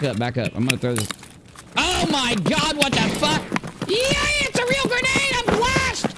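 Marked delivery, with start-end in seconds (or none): 1.8-6.1 very high panicked yelp; shocked shriek. Real, not acted. Gets flashbanged by grenade.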